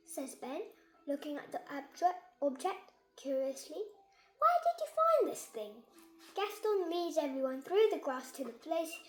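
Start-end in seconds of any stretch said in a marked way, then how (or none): 4.4-5.8 high, eager question; excited question. Acted. Child asking Gaston about the tin arm, excited. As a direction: ask it with wide-eyed wonder.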